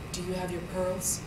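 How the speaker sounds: hushed, solemn question. Retold or imitated. Mother to daughter on the phone about pearls, solemn. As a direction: ask it softly and gravely, up close. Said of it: "she got real solemn".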